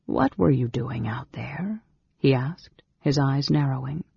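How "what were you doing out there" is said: hushed, probing question; suspicious whisper. Acted. Mage questioning the girl caught listening, suspicious whisper. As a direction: interrogate in a whisper.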